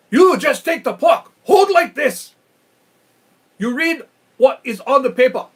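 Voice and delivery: irritated director. Retold or imitated. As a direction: bark the orders at him, fed up. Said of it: "the director is now irritated, mad, and frustrated".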